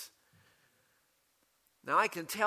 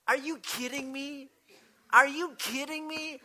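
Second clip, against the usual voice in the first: rising disbelief, higher and louder the second time; incredulous; 8 semitones higher, 3 dB louder than usual. Retold or imitated. Preacher voicing Paul in everyday terms, growing incredulous. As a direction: build from surprise to outrage. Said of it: "Paul is saying".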